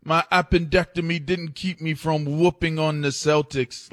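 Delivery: high-pitched bragging athlete; Joel Embiid. Acted. Player bragging about whooping the Celtics after surgery, high-pitched boast. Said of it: "Joel Embiid was coming off of a appendectomy".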